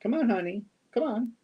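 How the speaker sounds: high sing-song pet voice; cooing to the cat. Real, not acted. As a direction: coax the cat over sweetly. Coaxing the cat to come.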